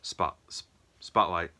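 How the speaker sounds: put-on halting, repeated tries at a Boston accent; attempted Boston accent. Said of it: "Boston accent".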